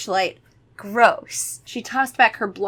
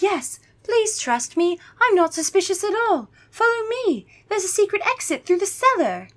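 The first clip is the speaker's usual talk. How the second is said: sugary fake-innocent; 11 semitones higher than usual. Gwendolyn luring the kids, hammed-up innocent. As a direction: overdo the innocence to hide a trap. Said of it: "And Gwendolyn's like".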